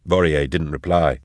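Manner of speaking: plain reading voice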